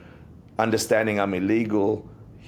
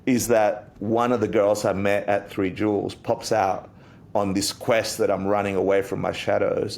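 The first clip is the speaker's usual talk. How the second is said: ordinary talk, nothing marked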